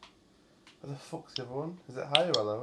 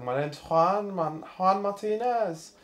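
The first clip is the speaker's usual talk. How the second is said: higher-pitched imitation Mexican accent; Mexican accent impression; 8 semitones higher, 4 dB louder, slower than usual. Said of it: "someone's brother did an impression on Snapchat".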